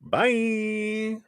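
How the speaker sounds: high, drawn-out singsong bye; playful dismissive goodbye; mock farewell. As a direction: wave them off with a long high "byeee". Mock farewell to those people.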